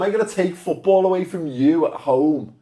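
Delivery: ordinary delivery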